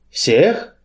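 usual speaking voice

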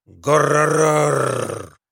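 long rolled growl of a car engine; drawn-out rolling "rrr" like a motor; shouted engine noise. A narrator's reading. Boy playing at being a car, engine roar. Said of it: "и кричал он".

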